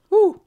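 loud high whew from chili burn; blowing out the heat; hot exhale. Real, not acted.

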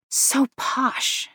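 high, delighted thought; light, thrilled little aside to yourself. A narrator's reading. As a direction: savour it with private delight. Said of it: "Becca thought delightedly".

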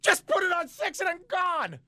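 high, fed-up plea; high fed-up retelling to the clerk; exasperated demand. Retold or imitated. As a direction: demand it high like you're done. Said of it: "Just give me all sixty back".

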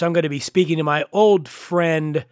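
normal talking voice

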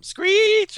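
very high, loud cartoon screech; screech sound effect. Power kicks in with a loud high screech. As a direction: squeal it like a comic sound effect.

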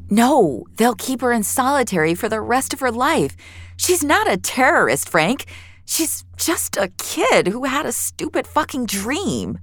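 put-on pleading anger on the girl's behalf; heated protest. Finch argues for the kid.

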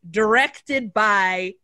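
slow, drawn-out credit read; dramatic announcement. Retold or imitated. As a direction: read it like a movie title card.

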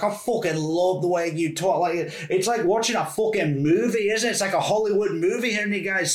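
imitating a delighted Scottish lassie. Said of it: "she was like".

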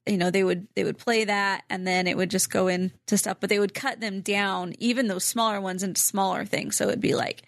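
ordinary delivery

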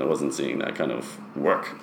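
normal talking voice

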